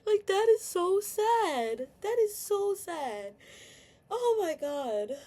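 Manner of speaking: high, slow, drawn-out wail; wailing so sad. Real, not acted. To her viewers, breaking down with a slow wail. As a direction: near tears, stretching every word. Venting, near tears on camera.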